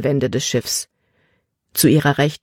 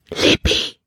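urgent whispered call. Acted. Caleb, finding Libby below deck, calling her in a whisper. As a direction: hiss her name so no one hears. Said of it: "Calebs Stimme war ein Flüstern".

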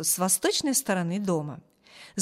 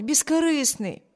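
higher-pitched, sincere praise; earnest defence; 5 semitones higher than usual. A narrator's reading. Sister defends Bruce to her brother, earnest. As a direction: insist on it gently and sincerely.

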